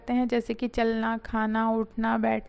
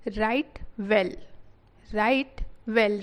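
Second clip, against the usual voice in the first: slow reading; 2 semitones lower, slower than usual. Shown as a demonstration. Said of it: "teacher reads the phrase twice".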